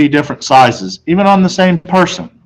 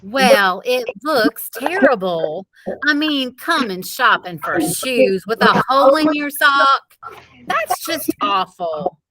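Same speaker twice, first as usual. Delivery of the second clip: higher, softer, slow fussing; fretting mother; 9 semitones higher, 6 dB quieter than usual. A narrator's reading. Mother fretting to Peter about his sock, slow and soft. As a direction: drag it out like a worried mother.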